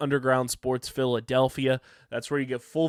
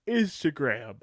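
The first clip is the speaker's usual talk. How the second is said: normal talking voice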